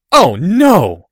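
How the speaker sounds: loud, high, drawn-out outcry; torn out of him. A narrator's reading. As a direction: wail it in horrified disbelief. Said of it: "like the words had been torn out of him".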